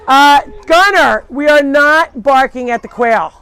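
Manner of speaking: loud, high, emphatic reprimand; scolding the dog. Real, not acted. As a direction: tell the dog off firmly, projecting loudly. Scolds dog barking at quail.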